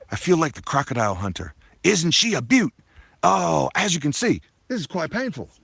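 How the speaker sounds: loud, hammed-up Aussie accent; bad Australian accent at full volume; Crocodile Hunter. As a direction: do a terrible Aussie wildlife-host voice, booming. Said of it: "that was a terrible Aussie accent".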